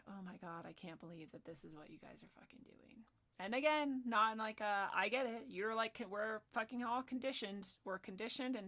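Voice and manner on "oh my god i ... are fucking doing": whispered disbelief, no voice; exasperated whisper. Retold or imitated. [name] imitating herself scolding her students in a whisper. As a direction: hiss it out in shocked despair, but keep it breathless. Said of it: "that I was like".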